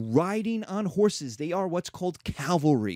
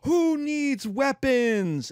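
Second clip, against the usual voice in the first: lightly higher, hammed-up TV-host handout; Oprah giveaway; 8 semitones higher than usual. Babylon handing revolts to everyone, light Oprah host voice. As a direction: hand out revolts like a game-show gift, a hint of Oprah. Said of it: "It throws money like Oprah".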